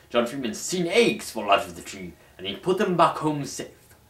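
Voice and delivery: ordinary delivery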